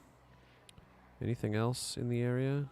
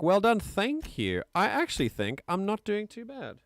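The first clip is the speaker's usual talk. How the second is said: excited; 12 semitones higher, 4 dB louder than usual. Real, not acted. Congratulating self on play.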